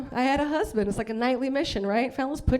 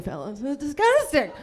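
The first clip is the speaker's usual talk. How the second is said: usual speaking voice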